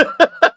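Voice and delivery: sudden loud high laugh; high burst of laughter. Real, not acted. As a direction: squeal of laughter. Laughs at confusing scene.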